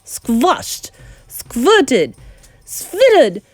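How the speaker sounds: high, loud witch orders; commanding witch. A narrator's reading. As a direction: bark out the decree.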